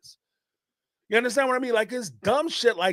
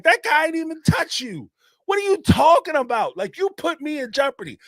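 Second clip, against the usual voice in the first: retold scolding; 9 semitones higher, 4 dB louder than usual. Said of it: "be like".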